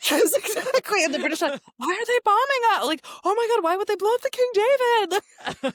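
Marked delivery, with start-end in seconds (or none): 1.8-2.9 high, loud feigned bewilderment; hammed-up aggrieved disbelief; mock outrage. Mimics aggrieved British under bombing. 3.2-5.7 high-pitched hammed-up shock; exaggerated aghast protest; mock horror. Mimics British shock at hotel bombing.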